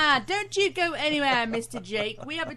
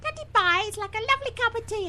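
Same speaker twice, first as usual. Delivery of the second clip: high British tea line; proper British lilt; cheerful British accent; 10 semitones higher than usual. Acted. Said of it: "she's got the accent down to a T".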